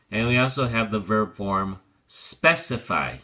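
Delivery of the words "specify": specify said slowly with the stress on SPEC, SPEC-i-fy; stress on first syllable. Shown as a demonstration. Teacher showing the stress shift to the class, slow. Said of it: "the accent changes to the first syllable".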